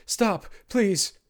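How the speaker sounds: high, loud begging in agony; screamed plea. A narrator's reading. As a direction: scream for it to stop. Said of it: "he screamed".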